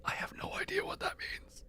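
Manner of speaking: whispered aside. Real, not acted. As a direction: whisper it to yourself. Aside to chat.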